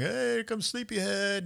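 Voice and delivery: high, slowed, sing-song wake-up call; mock the sleepy friend lightly; teasing roommates. Friends greeting the stumbling sleepyhead, high and teasing.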